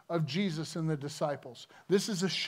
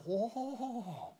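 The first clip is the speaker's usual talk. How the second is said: softer, awestruck murmur; awed hush; 6 dB quieter, slower than usual. Retold or imitated. Disciples after the storm stops, a hushed awed murmur. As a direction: breathe it out in disbelief. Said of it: "they went".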